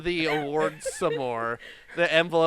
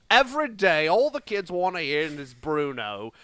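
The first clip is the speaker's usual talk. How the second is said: hammed-up British accent, pitched up at first; 5 dB louder than usual. Podcaster reading rap lyrics on request, mock British accent. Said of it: "Read it in your British accent".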